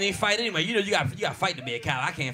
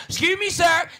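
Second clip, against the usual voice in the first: much higher, louder prissy wife; mock wife voice; 10 semitones higher, 6 dB louder, slower than usual. Wife addressing the robber, high mock voice. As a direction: squeak it like his wife.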